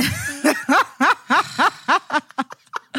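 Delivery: high, rolling burst of laughter; long real laugh. Real, not acted. Podcast host cracks up at her own punchline, long high laugh.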